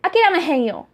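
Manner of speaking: Kansai Ben with "hen" in place of nai; Kansai dialect. Retold or imitated. Showing how Osaka people would say it, light Kansai dialect. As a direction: sound like an Osaka local. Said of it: "they'd be like".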